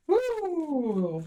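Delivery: excited whoop. Real, not acted. Energizing card drawn.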